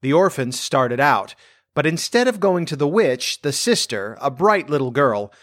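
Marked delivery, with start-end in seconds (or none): none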